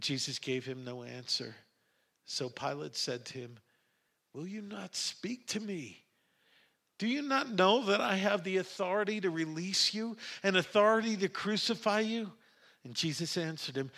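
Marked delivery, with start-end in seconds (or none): 4.3-12.4 pressing disbelief. A narrator's reading. Pilate demands answer from silent Jesus, pressing disbelief. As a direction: press for an answer, pitch held high.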